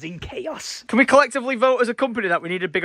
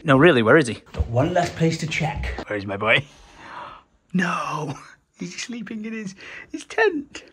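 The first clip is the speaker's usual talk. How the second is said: ordinary delivery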